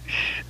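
hissed "shh", unvoiced; annoyed shush. A narrator's reading. Library patron shushing the old man, annoyed hiss. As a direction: hiss for silence. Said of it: "in an annoyed voice".

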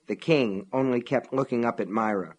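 plain reading voice

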